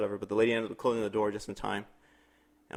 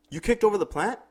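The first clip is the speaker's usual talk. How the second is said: much higher, accusing question; 10 semitones higher than usual. Retold or imitated. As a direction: call her out on the plant. Girlfriend accusing neighbor.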